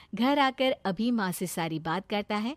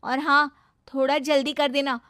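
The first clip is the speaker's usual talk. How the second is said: lightly voiced, higher, insistent girl; bossy young woman character; 5 semitones higher, 7 dB louder than usual. Girl tells her suitor to refuse the match himself, bossy. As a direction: play her a touch higher and pushy.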